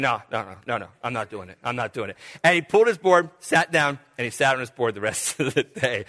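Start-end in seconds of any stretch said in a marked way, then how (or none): 0.0-2.2 low, much softer frightened no; quietly backing out, voice sunk low; a dazed brother refusing, low and soft; scared refusal. Retold or imitated. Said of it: "He said".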